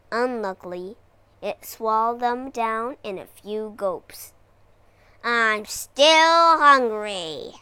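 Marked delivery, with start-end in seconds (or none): 5.2-7.5 slow, louder grumbling dragon; grumbled. Acted. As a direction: moan it like a greedy beast. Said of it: "it grumbled".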